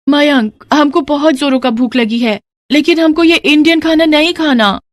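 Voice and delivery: sweet naive foreign daughter-in-law; foreign bride. Acted.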